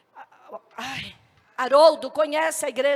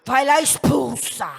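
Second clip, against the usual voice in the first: slow, drawn-out solemn order; commanding voice; 2 semitones lower, 3 dB louder, slower than usual. Retold or imitated. A voice sends the girl out to expel the demon, slow command. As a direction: command it slowly and weightily. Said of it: "ouvi uma voz que falou assim".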